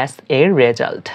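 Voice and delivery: slow, deliberate phrase; teacher pronouncing slowly; slow demo. Shown as a demonstration. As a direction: spell it out for students. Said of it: "পরিণাম হিসেবে আমরা ইংরেজিতে বলবো".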